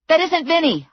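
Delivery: high, sharp protest; urgent protest. A narrator's reading. Heroine protesting to the thug, high and urgent. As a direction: cut in before he hits again. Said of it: "I said".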